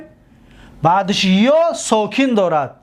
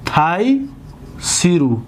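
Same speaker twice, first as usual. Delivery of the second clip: تیسرو stretched out very slowly, syllable by syllable; slow demo; 3 semitones lower, much slower than usual. Shown as a demonstration. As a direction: spell out تیسرو slowly for the class. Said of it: "ببینید".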